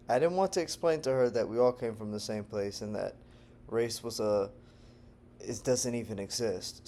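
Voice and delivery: ordinary delivery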